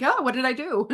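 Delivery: a high, hammed-up "what did I do"; mock panic. Guest mimics her regret after saying yes, mock panic. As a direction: play a mock panic at your own rashness. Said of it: "And then I'm like".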